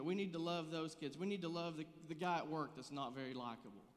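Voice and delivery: impassioned preaching. Acted. Preaching builds to full force.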